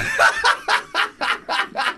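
high, sustained peals of laughter; long laughter. Real, not acted. Host cracks up at jibe about friendship, long laughter. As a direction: laugh helplessly for a good while.

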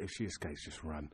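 flat, quiet, dead serious; farmer's quiet deadpan warning; deadly serious. Retold or imitated. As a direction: deliver it low and quiet, no joke. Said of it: "And, and deadly serious".

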